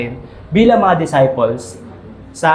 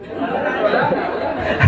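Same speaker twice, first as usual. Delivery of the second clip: real laugh; 10 semitones higher, 5 dB quieter than usual. Real, not acted. Mic fell, laughing.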